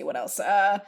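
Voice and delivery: normal talking voice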